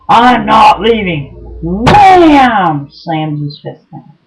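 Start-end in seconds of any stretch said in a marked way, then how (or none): 0.1-1.3 loud, slow, defiant shout; mock angry refusal. Ex-boyfriend refusing to leave the house, loud slow defiance. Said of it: "screaming at me". 1.6-2.9 loud sharp slam sound, higher; imitated fist bang. Telling how he slammed the table, loud imitated bang.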